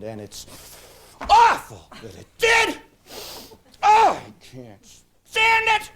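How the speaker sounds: loud, dramatic inner-voice rant; the horse's voice. Shown as a demonstration. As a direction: ham up the whining horse. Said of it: "say it the way the horse says it".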